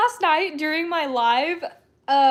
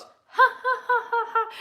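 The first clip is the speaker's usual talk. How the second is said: high-pitched mimicked burst of laughter; imitated hard laugh; 9 semitones higher than usual. Retelling how a friend got caught, imitated hard laughing. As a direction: act out someone cracking up in a string of ha-has. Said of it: "He starts laughing so hard".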